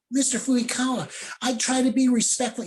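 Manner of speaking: mock-innocent goody-two-shoes whine; high pleading protest. Teen self protesting to teacher.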